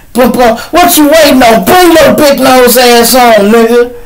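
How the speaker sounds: high, loud taunting yell from the car; calling him out to come fight, way up high and loud; mock fight challenge. Old street friend taunted from the car, high and loud. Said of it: "I'd be sitting there".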